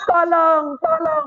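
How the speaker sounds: high-pitched panicked call; hammed-up plea rising to a squeal; mock cry for help. Storyteller plays stricken soldiers to children, high mock cry. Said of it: "Mereka sambil teriak".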